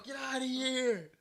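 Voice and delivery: high, loud, drawn-out dismissal; mock shout. Friend joins in chasing off the man, mock shout. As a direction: playfully yell someone away.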